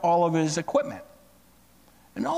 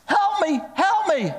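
high-pitched, urgent cry for help; mock-panicked yell, much higher; acted yell for help; 12 semitones higher than usual. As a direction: call out as if running for your life. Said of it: "he yelled out to the farmer".

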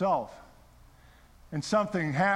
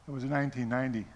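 ordinary talk, nothing marked

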